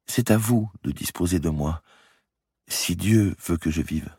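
put-on high, gentle voice of a sick girl; frail young woman. A narrator's reading. Sick girl pleading with Guccio to stay, frail. Marie's voice in story.

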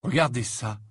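ordinary delivery

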